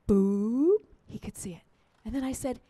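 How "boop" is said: rising notification boop; little upward-gliding blip; message alert boop. Retold or imitated. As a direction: imitate a text arriving.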